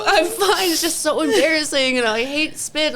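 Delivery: high, slow, crying voice insisting she's fine; tearful. Retold or imitated. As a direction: say it high and slow, holding back sobs. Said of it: "I'm like".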